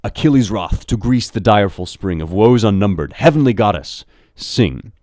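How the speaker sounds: ringing recital of a poem's opening; reading verse aloud. Shown as a demonstration. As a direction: read it out loud and stately, like heroic poetry. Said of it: "So here's Pope again".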